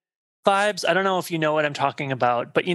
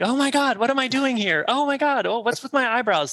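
much higher, hammed-up panicked gasps; mock flustered actress; 8 semitones higher than usual. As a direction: play her as a dithering, embarrassed starlet.

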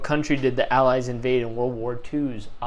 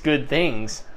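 usual speaking voice

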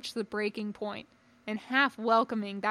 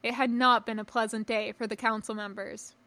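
ordinary delivery

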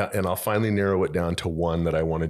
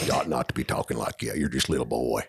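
Sling Blade voice; 5 semitones lower than usual. Retold or imitated. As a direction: lower rural mumble, half-swallowed words. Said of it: "You guys know Sling Blade?".

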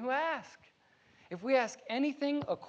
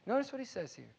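usual speaking voice

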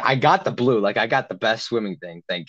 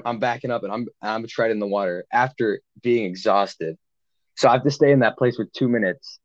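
ordinary delivery

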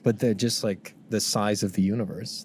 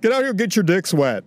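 Justin impression; 9 semitones higher than usual. Guest imitating host Justin calling the boys, pitched up. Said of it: "it's like the Justin face".

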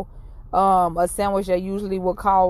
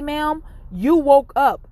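higher, drawn-out and insistent; slow emphatic; 5 semitones higher, 4 dB louder, much slower than usual. Retold or imitated. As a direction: press each word home slowly to the crying woman. Comforting a crying stranger.

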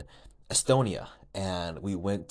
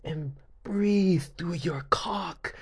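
mock guide; 7 semitones higher, much slower than usual. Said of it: "talking about".

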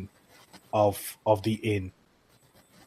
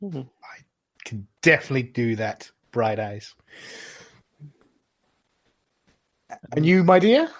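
barmaid voice; 2 semitones higher than usual. Acted. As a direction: answer sweetly then turn to her. Innkeeper continues serving.

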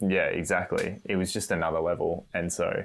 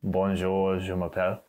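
put-on French accent. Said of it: "you did the accent and everything".

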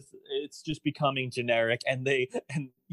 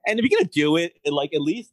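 louder, pushing the point; emphatic; 9 dB louder than usual. Real, not acted. To his cohost about the show, emphatic and loud. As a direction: press the idea with energy. Urging a better ending, emphatic.

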